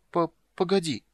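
put-on high, stammering little-girl plea; hesitant child. A narrator's reading. Girl calls back the departing witcher, hesitant. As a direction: stumble on the first sound, high and unsure.

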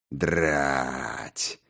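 low, drawn-out curse; undisguised disgust. A narrator's reading. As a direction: drag the word out in disgust. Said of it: "протянул он с нескрываемым отвращением".

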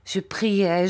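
plain reading voice

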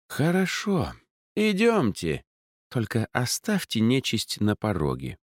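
put-on reluctant, lofty old mage; haughty mage voice. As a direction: warn her with disdain.

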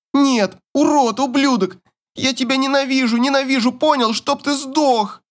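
put-on very high, hateful girl's cry; desperate screaming. A narrator's reading. Daughter curses her father, high screamed hatred. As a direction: curse him in a sustained high cry, little extra volume. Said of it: "кричит она так громко, как никогда прежде".

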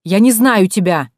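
loud protest. A narrator's reading. Doorman protests.

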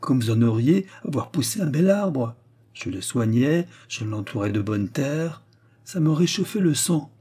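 ordinary delivery

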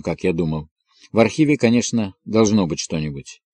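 ordinary delivery

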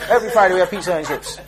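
laughing disbelief. Retold or imitated. Preacher laughing at the brother's prison pizza. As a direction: repeat it through a laugh. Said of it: "he said".

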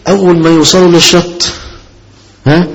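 ordinary talk

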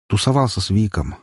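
ordinary delivery

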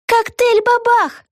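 put-on very high, drawn-out girlish cry; excited announcement. A narrator's reading. Vega waving the menu at her friend, high excited cry. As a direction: announce it slowly in a high little-girl voice.

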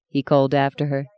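ordinary delivery, nothing marked

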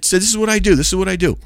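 high-pitched defensive retort; retold arguing back. Preacher defending his street work, retold arguing back. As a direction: act out insisting back at a heckler.

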